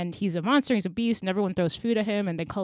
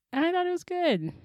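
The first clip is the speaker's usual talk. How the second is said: normal talking voice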